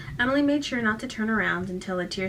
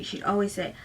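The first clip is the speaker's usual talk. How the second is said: ordinary delivery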